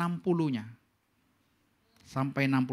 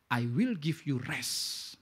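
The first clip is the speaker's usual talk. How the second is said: solemn slow quote; 3 semitones lower, much slower than usual. Acted. Quoting Jesus in English.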